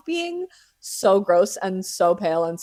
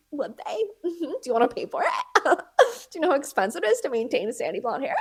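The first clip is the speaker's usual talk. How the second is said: sassy; 8 semitones higher, faster than usual. Retold or imitated. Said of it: "And I'm like".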